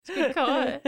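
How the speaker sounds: giggling out the punchline; laughing. Real, not acted. Joins the laughter.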